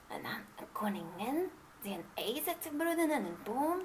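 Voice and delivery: whispered anxious question; queen whispers her doubt to the king. Acted. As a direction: ask him under your breath.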